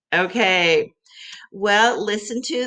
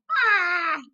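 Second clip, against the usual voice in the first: mock cry; 12 semitones higher than usual. Teacher acting out feeling sick.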